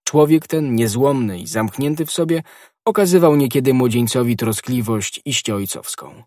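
ordinary delivery

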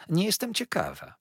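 high, sulky refusal; pouting. A narrator's reading. Young wife sulking at her husband, pouting high. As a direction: toss it off in a pout. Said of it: "nadąsała się Dora".

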